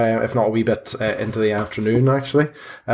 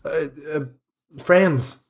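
high, frantic priest demanding answers; flustered priest, much higher; Father Lucian's panicked voice; 7 semitones higher than usual. Acted. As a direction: pitch way up, alarmed and bewildered. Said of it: "Father Lucian just goes".

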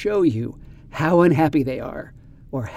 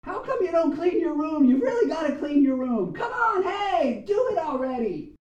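much higher, mock yell with a laugh; playful high-pitched scolding yell; 13 semitones higher, 3 dB quieter than usual. Shown as a demonstration. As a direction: yell at the kid to clean up. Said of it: "here is an example".

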